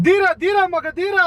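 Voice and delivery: high, forceful heroic line delivery; film dialogue. Shown as a demonstration. Kidnapper teaching his captive a line, as film dialogue. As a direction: declaim it like a movie punchline. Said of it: "ಒಂದ್ dialogue ಹೇಳ್ಕೊಡ್ತೀನಿ".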